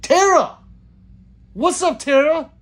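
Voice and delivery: high, loud hail to a friend; excited greeting. Real, not acted. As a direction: call out a big hello. Calls out greeting to arriving viewer.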